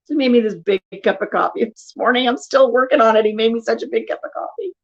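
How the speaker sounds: normal talking voice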